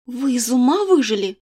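furious heroine hissing at the king; venomous hiss. Acted. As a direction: spit it out like a viper. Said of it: "прошипела, как самая ядовитая".

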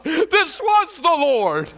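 high, slow, elated realization. Retold or imitated. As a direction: lift it in joyful wonder. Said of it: "I thought".

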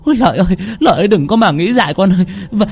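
high-pitched keening mother; tearful wailing. A narrator's reading. Mother begs Lợi to come home, tearful wail. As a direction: wail and plead, stretching the last call. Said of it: "Mẹ thằng Lợi sụt sùi khóc".

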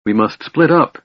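decisive and resolved. A narrator's reading. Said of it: "she said decisively".

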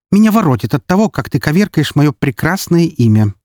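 high, quick-starting indignant complaint; angry disgust. A narrator's reading. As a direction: voice an offended woman fed up with him. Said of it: "исказилось в гримасе злости". (возмущённо, со злостью)

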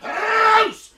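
screaming get out; furious shout. Acted. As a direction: yell him out of the house. Throwing neighbour out furiously.

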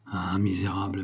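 murmured. A narrator's reading. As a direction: murmur it softly to yourself. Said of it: "murmura-t-elle".

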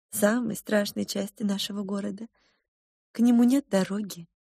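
lightly voiced cook mother. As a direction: explain gently to a child. Cook mother on father's fate.

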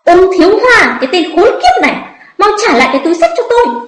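high-pitched furious demand, racing at the end; angry loud scolding. A narrator's reading. Woman tugging her bag back from the man, scolding angrily. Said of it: "vừa tức giận mắng to".

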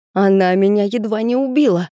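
mild narrated yell of outrage. A narrator's reading. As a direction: cry out in complaint, moderately restrained. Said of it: "заорал ублюдок".

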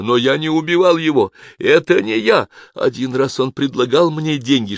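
high, loud protest of innocence; anxious, insistent self-defence; desperate pleading. A narrator's reading. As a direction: beg to be believed. Guard pleads his innocence.

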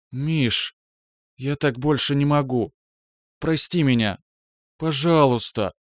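tearful plea. Acted. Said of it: "Упадающий голос Браиловой позванивал".

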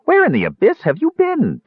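high, sharp demand from the kender; a high-pitched indignant demand. Acted. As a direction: pounce on him with the question. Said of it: "Tass demanded".

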